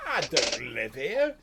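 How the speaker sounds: slow, loud goblin drawl. Acted. Goblin correcting the player, slow. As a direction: goblin protesting, slow and drawn. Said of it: "He's like".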